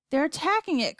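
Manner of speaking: high, alarmed call; crying out. A narrator's reading. Boy seeing the capsule attacked, crying out. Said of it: "cried Charlie".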